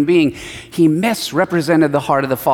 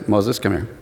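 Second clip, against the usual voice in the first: lower, softer summons; God calling Moses; 8 semitones lower, 3 dB quieter than usual. Retold or imitated. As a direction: call him over quietly. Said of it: "But then God says".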